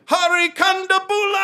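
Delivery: big ringing intro, last syllable held; John Oliver. Acted. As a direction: belt out the name like introducing a comic. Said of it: "yeah, he's like".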